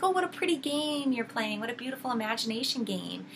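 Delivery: high, put-on nice voice; sweetly condescending witch. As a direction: humour them sweetly, as if indulging a child's game. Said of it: "all very nice".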